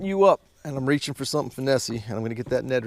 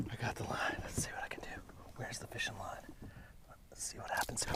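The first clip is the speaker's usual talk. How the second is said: hushed whisper while landing a fish; tense whisper; 18 dB quieter than usual. Real, not acted. Angler whispering to viewers while handling the line. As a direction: whisper so you don't spook the fish.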